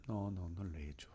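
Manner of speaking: whispered, embarrassed admission; sheepish whisper. Retold or imitated. Sister whispering to the bishop, ashamed. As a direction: breathe it out, caught not having done it.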